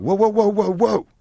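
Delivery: high, loud urgent whoas; alarmed warning call; instructor yelling stop. Retold or imitated. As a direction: shout to stop him like a range instructor. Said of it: "that's when the instructor in me comes out".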